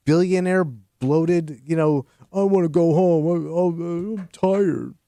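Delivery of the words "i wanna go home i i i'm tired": lightly put-on whiny, tired old rocker; mock weary rock star. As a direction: moan it in mock exhaustion. Mocks bloated billionaire rockers complaining.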